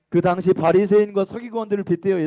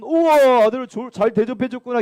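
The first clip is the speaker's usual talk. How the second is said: mock amazement; 6 semitones higher, 6 dB louder than usual. Preacher voices a naive reading.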